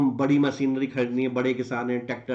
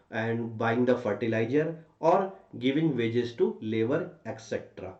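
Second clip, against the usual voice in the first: slow, spaced-out dictation; dictating; much slower than usual. Shown as a demonstration. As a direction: say it slowly for students to write down. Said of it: "तो मैं बोल देता हूँ".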